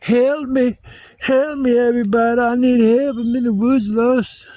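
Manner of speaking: very high, loud frantic cries for help; crazed panic. Pretend. Imagined crazy man lost in the woods, frantic. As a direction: scream for help like a lost madman.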